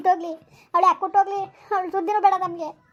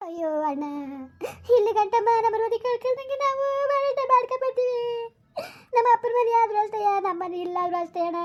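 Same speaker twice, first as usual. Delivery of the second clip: tearful lament; 3 semitones higher than usual. Acted. Woman lamenting lost family honour to her brother, tearful wail. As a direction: wail out the grief in long, sagging phrases.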